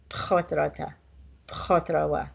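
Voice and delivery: pchotrata starting with a closed-mouth p; p sound. Shown as a demonstration. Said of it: "Close your mouth and make the p sound".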